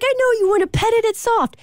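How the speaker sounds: put-on high, fast sing-song baby talk; high cooing voice to a little boy; baby talk to toddler. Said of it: "And I'm like".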